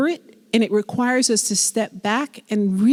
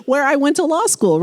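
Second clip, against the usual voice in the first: exasperated retort; 7 semitones higher than usual. Retold or imitated. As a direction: play fed up with the questioning. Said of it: "I'm like".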